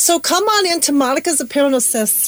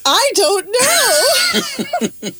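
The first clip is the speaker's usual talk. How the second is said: laughing; 6 semitones higher, much slower than usual. Real, not acted. Laughing at her own accent attempt.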